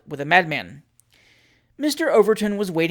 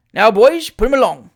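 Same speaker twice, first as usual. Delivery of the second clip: put-on high, loud order to the boys; calling out; 5 semitones higher, 11 dB louder than usual. Mayor ordering the boys off, loud cry. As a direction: command the post-boys to go. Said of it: "cried the mayor".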